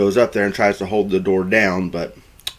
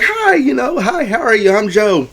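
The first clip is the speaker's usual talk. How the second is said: very high, over-friendly woman's hello; mock gushing greeting; 13 semitones higher than usual. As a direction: introduce yourself in a piping, put-on sociable voice. Jo greets the fiancee.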